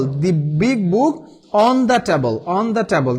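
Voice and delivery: each word drawn out, teacher pace; slow model answer. Shown as a demonstration. Teacher reading the finished sentence to students, slow and deliberate. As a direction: spell out the answer slowly for the class. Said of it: "slow model answer".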